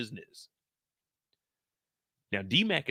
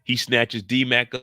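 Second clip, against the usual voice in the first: usual speaking voice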